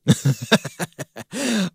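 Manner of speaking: laughing. Real, not acted. Laughing at the rotting drum set.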